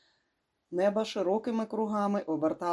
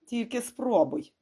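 slow, higher-pitched threat; growled warning; 6 semitones higher than usual. A narrator's reading. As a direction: draw it out as a menacing warning. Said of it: "прогарчав Демарко". (повільно, з погрозою прогарчати)

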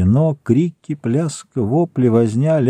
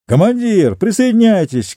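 shouting; 9 semitones higher than usual. A narrator's reading. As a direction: call out over the noise. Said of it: "крикнул Чероки". (крик)

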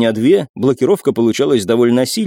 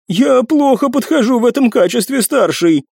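high timid servant voice; 11 semitones higher than usual. Acted.

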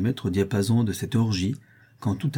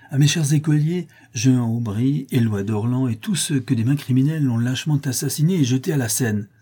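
raised, higher voice, hurling the names at them; defiant accusing toast; 4 semitones higher, 4 dB louder than usual. Acted. Buridan raises his cup to accuse the killers, loud defiant toast.

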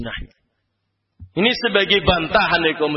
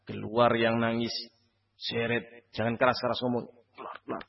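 much softer, urging mourners out under the breath; hushed order; 6 semitones lower, 9 dB quieter than usual. Retold or imitated. Preacher voicing a man ushering weeping relatives out, hushed. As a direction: shoo the criers out quietly without disturbing the dying.

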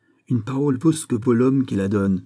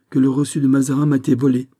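quick, higher and louder; agitated declaration; 5 semitones higher, 5 dB louder, faster than usual. Acted. Fouquet tells Aramis of the theft, agitated. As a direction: burst out with the alarming conclusion.

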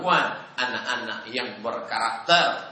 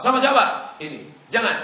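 mock challenge; 10 semitones higher, 6 dB louder than usual. Menegur anak yang dibawa pergi.

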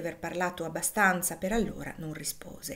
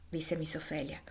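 ordinary delivery

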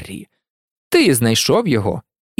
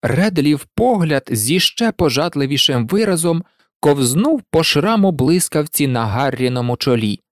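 ordinary delivery

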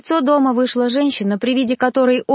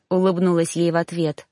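usual speaking voice

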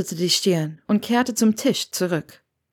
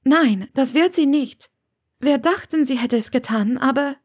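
slightly raised character voice; lightly voiced earnest woman; 7 semitones higher than usual. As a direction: voice a woman carefully explaining herself. Narrator voices Hermione.

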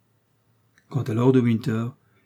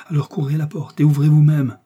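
louder, higher command; pressing, raised-voice instruction; urgent order; 5 semitones higher, 8 dB louder than usual. A narrator's reading. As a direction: send him off at once.

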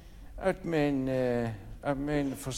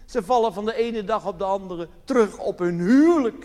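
ordinary talk, nothing marked

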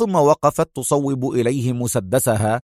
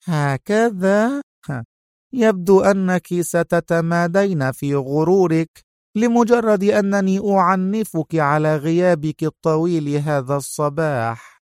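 higher, slow, icy reproach; cold and cutting; 6 semitones higher than usual. Acted. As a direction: lean in and chill her. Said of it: "مالت سونيا نحوها وهي تقول في برود". (ببرود قاس)

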